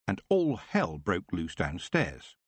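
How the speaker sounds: ordinary delivery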